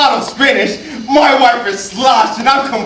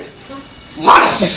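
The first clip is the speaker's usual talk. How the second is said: whooshing, hissed flush noise; imitated toilet flush. Note goes down the toilet, whooshed flush sound. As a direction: make the word into rushing water.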